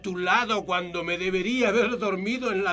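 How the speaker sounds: high, desperate pleading; frantic, pushing her away; panicked self-reproach. Acted. Doctor panics he infected wife.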